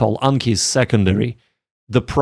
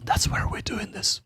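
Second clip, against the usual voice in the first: whisper; 9 semitones higher, 12 dB quieter than usual. Real, not acted. Quiet aside to viewers.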